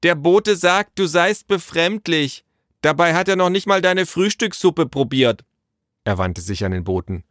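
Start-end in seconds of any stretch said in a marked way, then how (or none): 0.0-5.4 high, raised calling voice; calling into the house. A narrator's reading. Naru passing the messenger's complaint to father, calling out. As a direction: relay it loudly indoors. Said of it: "rief wieder ins Haus hinein".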